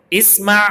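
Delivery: Arabic اسمع, read aloud to students; Arabic word read out. Shown as a demonstration. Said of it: "دیکھیے".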